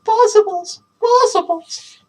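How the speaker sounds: high, slow trying-out of the word; slowly savoring. Acted. Deb trying out the new name, slow and high. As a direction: let it sink in. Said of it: "Deb said the word slowly".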